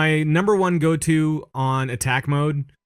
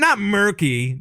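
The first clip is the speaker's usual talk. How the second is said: not murky, with murky said higher; stress on murky; 6 semitones higher, slower than usual. Shown as a demonstration. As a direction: correct murky, pitched up.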